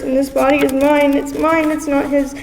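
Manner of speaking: anguished protest. Acted. Poet's anguished claim over her body.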